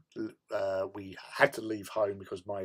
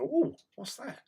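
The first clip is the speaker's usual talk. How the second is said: curious surprise; 13 semitones higher than usual. Retold or imitated. Retold moment of spotting something.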